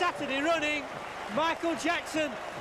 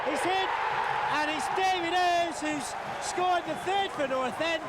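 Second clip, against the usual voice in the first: high shouted goal call; excited goal call; 3 semitones higher than usual. Real, not acted. Commentator calls a goal.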